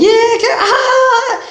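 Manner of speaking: very high, squealing mock gossip; mock excited gossip. Imitating someone spreading rumors to others, mock excited squeal.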